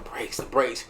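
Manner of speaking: whispered Brakes, the brakes. Shown as a demonstration. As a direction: hiss the word brakes under breath. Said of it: "And I'm like".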